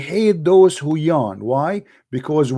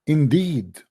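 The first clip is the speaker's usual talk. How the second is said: ordinary talk, nothing marked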